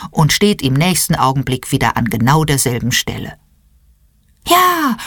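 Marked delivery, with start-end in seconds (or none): 4.5-5.0 sudden cry. A narrator's reading. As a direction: burst out with it. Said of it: "ruft Engel Malfriede plötzlich".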